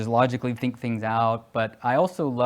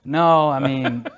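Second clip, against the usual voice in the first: laughing; 7 dB louder, much slower than usual. Real, not acted. Laughing at drill instructor joke.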